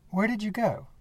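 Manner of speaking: high, uneasy call into the empty farm. Acted. Calling out for vanished man.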